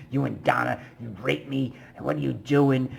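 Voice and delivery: hammed-up ranting blame; mock accusing yell. Homeless woman accusing him in the library, mock yell. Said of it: "She's like".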